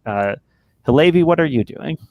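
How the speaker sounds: ordinary talk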